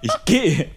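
laut und hoch betontes "Ich gehe"; "Ich gehe" hoch und laut mit Nachdruck; Betonung mit Körpereinsatz. Shown as a demonstration. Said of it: "versuche diese Betonung körperlich zu unterstreichen". ("Ich gehe" betont vorgeführt)

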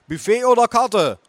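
high, loud question; calling mother. Acted. Mother asking grandma's order, calling out. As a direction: call across to grandma.

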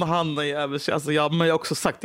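ordinary talk

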